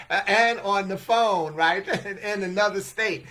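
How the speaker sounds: talking through loud laughter; words spilling out laughing. Real, not acted. Laughing while telling.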